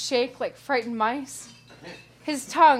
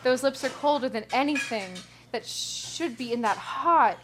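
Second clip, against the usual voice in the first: plain reading voice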